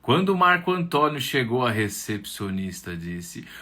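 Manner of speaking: ordinary delivery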